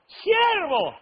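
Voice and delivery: high, drawn-out falling call across a crowd; imitated shout. Young man spotting the preacher among the people, mock high shout. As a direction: mimic him hollering the word from afar.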